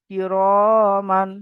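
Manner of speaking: very slow chanted কিরমান কাতিবিনা, each syllable drawn out; slow Quran recitation. Shown as a demonstration. Teacher leading students in slow recitation. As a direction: recite it slowly for the class to repeat. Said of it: "বলুন আমার সঙ্গে।".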